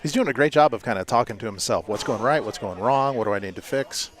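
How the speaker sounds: ordinary talk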